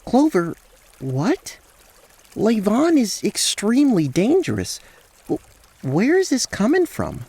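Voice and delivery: high, bewildered character voice; astonished, urgent concern; alarmed Rael. Acted. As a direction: question a friend in disbelief.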